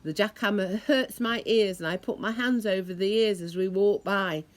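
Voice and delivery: ordinary delivery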